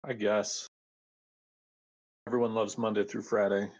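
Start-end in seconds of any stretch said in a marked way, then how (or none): none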